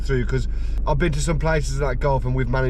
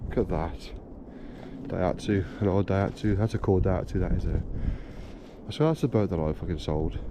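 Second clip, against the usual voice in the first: hushed; 5 semitones lower, 8 dB quieter than usual. Real, not acted. Quiet remarks while looking round the cars.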